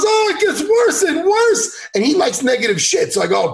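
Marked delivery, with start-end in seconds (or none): 0.0-1.9 high, slow, laughing coach voice; hammed-up old coach, giggly and strained; Ray Longo impression. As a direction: relish the bad news, laughing up high. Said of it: "He goes".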